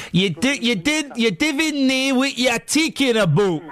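slow, laboured Geordie imitation; hammed-up Newcastle accent; mock Geordie accent. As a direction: have a go at broad Geordie, drawn out. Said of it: "when I do that accent".

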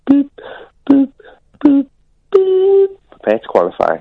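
robotic game announcer; arcade game voice. Retold or imitated. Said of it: "Do it again".

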